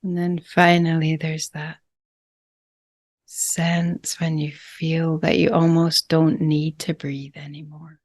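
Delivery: calm, unhurried breathwork guide with long pauses; gentle meditation leader, phrase by phrase; guided meditation. Real, not acted. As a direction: ease them into each breath, leaving lots of space.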